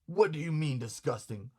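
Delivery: ordinary delivery, nothing marked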